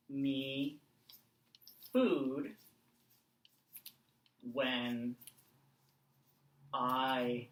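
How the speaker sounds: each word spaced out slowly; slow, spaced-out writing voice; slow dictation. Shown as a demonstration. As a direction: dictate one word at a time as you write. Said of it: "dictates letter word by word while writing".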